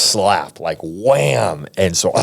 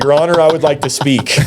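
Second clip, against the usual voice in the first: higher-pitched, bold address to the judge; mock courtroom plea; 7 semitones higher, 3 dB louder than usual. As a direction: pipe up as a defiant defendant.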